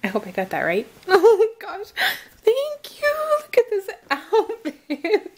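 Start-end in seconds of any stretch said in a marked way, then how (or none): 1.1-5.3 high, laughing delight; real giddy excitement; overjoyed squeal. Real, not acted. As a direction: squeal your thanks, thrilled. Overjoyed at another gift outfit.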